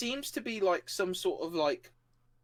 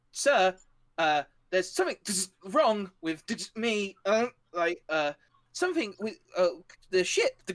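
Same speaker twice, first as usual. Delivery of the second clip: higher voice, halting and glitching; distressed, broken-up AI voice; Valor; 6 semitones higher, 4 dB louder than usual. Acted. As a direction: stammer a report like a malfunctioning machine. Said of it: "And you hear Valor go".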